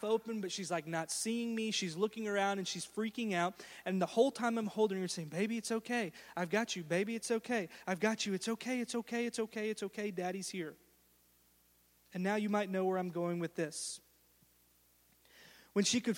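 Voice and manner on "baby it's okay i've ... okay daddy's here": soft, tender repeated reassurance; tender father's murmur growing softer; comforting daddy. Retold or imitated. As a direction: hold her and calm her. Father holding terrified daughter.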